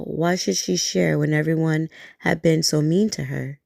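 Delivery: ordinary delivery, nothing marked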